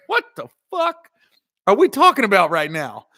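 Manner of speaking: mock bewildered outburst at the fishing talk; high, baffled what-are-we-doing; incredulous. Narrator's incredulous inner reaction.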